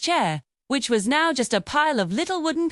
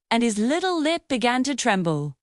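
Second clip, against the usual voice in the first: usual speaking voice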